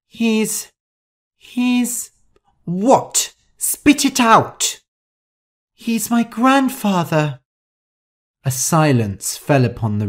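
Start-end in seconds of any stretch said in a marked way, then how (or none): 0.1-2.1 high, halting start; frightened stammer. Acted. Shu trying to confess to Rosa, frightened stammer. 2.6-4.8 high, drawn-out angry order; impatient demand. Acted. Rosa pressing stammering Shu to finish, impatient and angry. 5.7-7.4 much higher, frightened voice; timid confession. Acted. Shu confessing to angry Rosa, frightened and timid.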